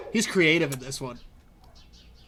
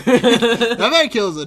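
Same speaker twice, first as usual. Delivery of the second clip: laughing; 6 semitones higher, 9 dB louder, much slower than usual. Real, not acted. Laughing while praising.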